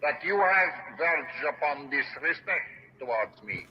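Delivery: put-on slow, grand, French-Russian tinged accent; a lordly despot in a muddled accent; fake Spanish accent. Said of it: "take French and Russian and slam it together".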